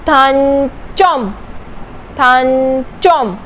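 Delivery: थानचोम drawn out slowly; slow pronunciation demo. Shown as a demonstration. Teacher repeating slowly for students. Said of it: "च की sound".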